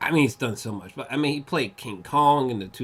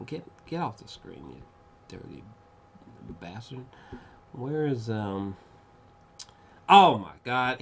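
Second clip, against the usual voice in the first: ordinary delivery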